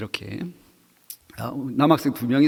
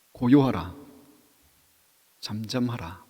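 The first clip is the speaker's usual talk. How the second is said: calm command; 5 semitones lower, 5 dB quieter than usual. Retold or imitated. Jesus commands wind and sea.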